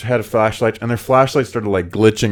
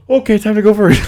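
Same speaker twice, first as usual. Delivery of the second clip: high-pitched joking psych-up; mock hurried resolve; 12 semitones higher, 4 dB louder than usual. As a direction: squeak it out as a pretend getaway. Acting out panicked sprint, joking.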